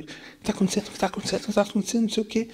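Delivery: confused man coming back to himself, afraid; frightened man. Retold or imitated. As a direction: repeat it scared and breathless. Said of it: "É assustado".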